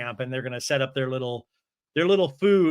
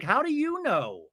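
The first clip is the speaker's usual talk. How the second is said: mock-wounded question, pitched up; high challenge; 8 semitones higher, 3 dB quieter than usual. Woman challenging her friend, in high mock indignation. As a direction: retort in a high put-on voice. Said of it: "And she's like".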